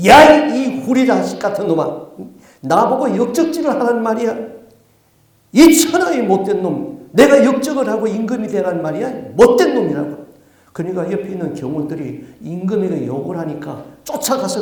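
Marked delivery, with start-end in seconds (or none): 0.1-10.2 loud, indignant rebuke of the king's questioner; angry scolding. Acted. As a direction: raise your voice and scold him. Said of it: "언성을 높이면서 이 사람을 꾸짖습니다". (언성 높여 꾸짖는 말투)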